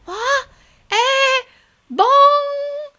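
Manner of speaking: high startled squeaks; confused gabbling. Acted. Wife waking with a start, high confused gabble. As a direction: jolt awake and gabble in confusion. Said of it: "she gabbled".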